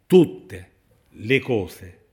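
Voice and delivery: loud "tutte", long gap, then "le cose"; very slow, forceful "tutte le cose"; emphatic repetition. Real, not acted. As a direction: split "tutte le cose" slowly for weight. Preacher repeats it after saying ripeto.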